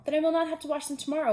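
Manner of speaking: quick excited Toad; eager rush. Acted. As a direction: hurry through, excited.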